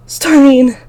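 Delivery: high, loud relieved cry of a name; relieved yell. A narrator's reading. Girl waking from a nightmare, calling for her sister. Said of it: "I yell".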